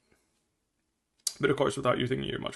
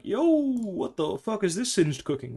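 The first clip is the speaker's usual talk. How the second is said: higher and slow, mock startled teammate; alarmed teammate; 6 semitones higher, much slower than usual. Coach voicing a teammate shaken by spam pings, slow mock alarm. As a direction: play the teammate jolted awake by the pings.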